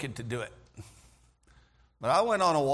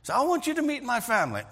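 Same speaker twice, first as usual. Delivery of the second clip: higher, eager, bubbling over; excited invitation; 8 semitones higher than usual. Retold or imitated. Farmer's host eagerly invites the builder home, excited and high. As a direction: invite him in with sudden delight. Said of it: "got all excited".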